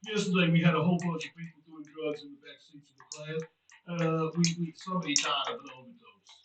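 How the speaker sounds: low droning cartoon counsellor; Mister Mackey impression. Counsellor warning kids off drugs, Mister Mackey voice. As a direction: lecture the kids in a low drone. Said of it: "my name's Mister Mackey".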